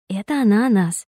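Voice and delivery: ordinary delivery, nothing marked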